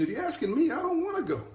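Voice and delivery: high comic lament; mock anguished plea. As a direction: squeak out "I don't wanna go" like a frightened child.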